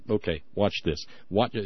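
ordinary talk

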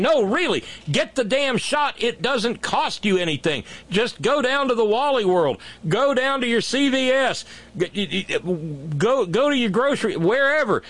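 high-pitched, pleading-mad sales pitch; exasperated urging. Pretend. Imagined ad telling Mississippians to get vaccinated, exasperated urging. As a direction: push them out the door, exasperated.

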